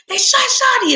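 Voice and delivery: high, loud panicked cry; mother telling her father her son was shot, crying out; frantic cry. Retold or imitated. Cry it out high and loud. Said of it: "And I'm like".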